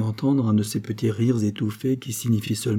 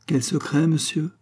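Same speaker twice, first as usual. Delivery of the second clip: higher-pitched, haughty interruption; hauteur royale; 6 semitones higher than usual. A narrator's reading. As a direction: cut in as a haughty queen. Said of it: "interrompit la reine avec hauteur". (avec hauteur)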